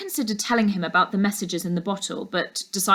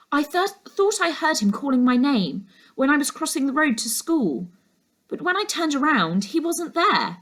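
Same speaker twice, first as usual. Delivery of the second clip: put-on higher, eager girl's voice; a girl rushing to explain her worry; anxious girl; 7 semitones higher than usual. Laura telling her uncle what she saw, higher and urgent.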